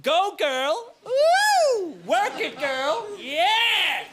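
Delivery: high, whooping catwalk hype; Gok Wan cheering. Retold or imitated. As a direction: cheer the models on like a host. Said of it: "Gok Wan ... with a wireless microphone going".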